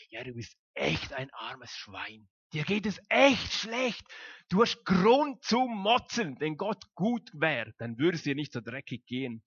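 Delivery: mock tempter urging you to complain; the evil one's voice. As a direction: play the devil egging you on to grumble. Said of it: "es flüstert uns ein".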